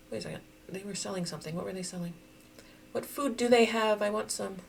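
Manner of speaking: normal talking voice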